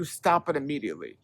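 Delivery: ordinary talk, nothing marked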